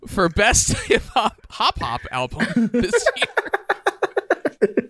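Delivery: high-pitched, struggling through laughter; laughing while reading. Real, not acted. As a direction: try to read on while cracking up. Host cracks up while misreading.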